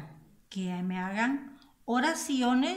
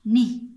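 "ni" punched loud by itself; single emphatic "ni"; stressed syllable; 11 dB louder than usual. Shown as a demonstration. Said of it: "tenemos la sílaba acentuada".

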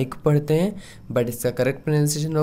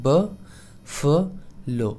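ब, फ, लो spoken as separate beats with pauses between; syllable by syllable; much slower than usual. Shown as a demonstration. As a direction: spell out buffalo one slow syllable at a time. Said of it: "आप नीचे लिखा भी है".

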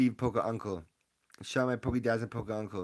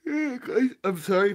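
squeaky high voice; 13 semitones higher, 7 dB louder than usual. Real, not acted.

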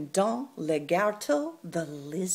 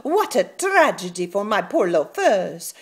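loud wail; 3 semitones higher, 13 dB louder than usual. Acted. Pig wails over his shoes.

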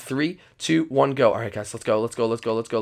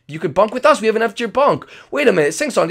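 usual speaking voice

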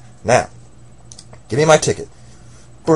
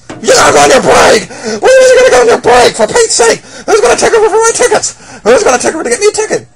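much higher, loud outraged yelling; furious shout; 11 dB louder than usual. Acted. Customer yelling furiously about the clerk's break. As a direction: blow up at the clerk.

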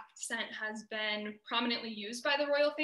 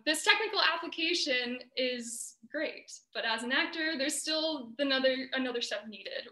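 normal talking voice

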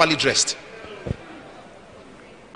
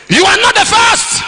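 very high, loud shout; full-force repeated punchline; preaching shout; 7 dB louder than usual. Acted. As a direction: hurl it at the crowd. Preacher hammers home the point.

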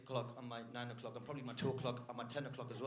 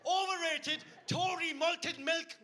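high, full-throated shouted tirade; comic angry rant; 12 semitones higher, 8 dB louder than usual. Acted. Comedian ranting to crowd about Biscoff, comic angry shouting. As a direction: yell it in outraged disbelief, pitched way up.

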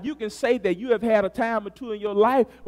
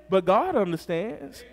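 ordinary talk, nothing marked

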